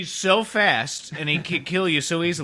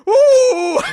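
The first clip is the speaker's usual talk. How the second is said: high shrieking laugh; 6 dB louder than usual. Pretend. Wild laugh in impression.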